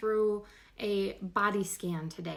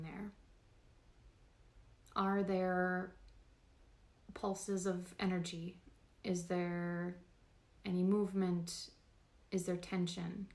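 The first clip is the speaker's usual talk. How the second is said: guided meditation; 5 dB quieter than usual. Real, not acted. Host guiding listeners through a body scan, calm meditation voice. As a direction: talk them gently through the body, unhurried.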